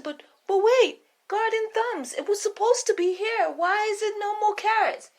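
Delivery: jittery cook in a squeaky high voice; nervous cook. Acted. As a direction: stammer excuses to your queen. Cook pleads.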